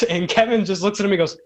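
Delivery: ordinary talk, nothing marked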